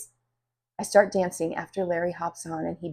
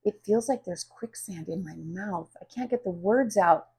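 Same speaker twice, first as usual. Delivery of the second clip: usual speaking voice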